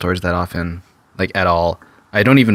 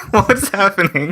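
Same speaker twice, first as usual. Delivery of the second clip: high, slow, laughing question; 11 semitones higher, slower than usual. Real, not acted. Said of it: "laughing reaction".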